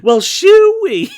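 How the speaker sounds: shoo-wee hollered way up high and slow; hammed-up client voice. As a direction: stretch it out like a goofy cartoon client. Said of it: "that's a lot of his voice".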